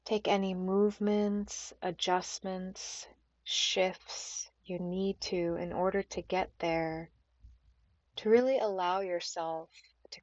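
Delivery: unhurried meditation guide with long pauses; calm, spacious yoga nidra voice; guided meditation. Acted. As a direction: ease them gently into stillness, phrase by phrase.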